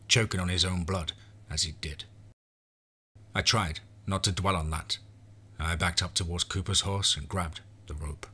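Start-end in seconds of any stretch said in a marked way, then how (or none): none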